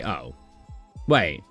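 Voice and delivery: normal talking voice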